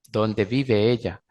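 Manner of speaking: normal talking voice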